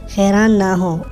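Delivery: ordinary delivery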